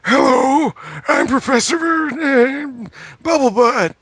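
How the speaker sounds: very high silly cartoon professor. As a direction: squeak it out like a goofy cartoon professor. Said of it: "The first time he's like".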